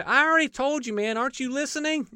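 high, fed-up retort; exasperated healed man. Retold or imitated. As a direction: throw it back at them, annoyed. Healed man answers questioners.

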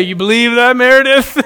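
giggling through a teasing question; mock grandpa voice, laughing; laughing tease. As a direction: try to ask it and crack up. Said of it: "I'll say something dumb, and say".

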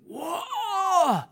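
high, loud, long wailing scream; imitated scream. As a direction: scream out like the man being prayed for. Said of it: "And next minute he screamed".

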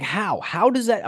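mock outrage. Berating a friend for ordering roast beef, in high mock outrage. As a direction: act appalled at his choice.

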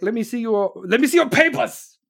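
loud, high mock German demand; German accent. Pizza place demanding papers from a family, mock German accent. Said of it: "They did it with a German accent".

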